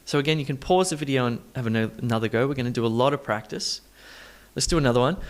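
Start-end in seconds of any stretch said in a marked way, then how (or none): none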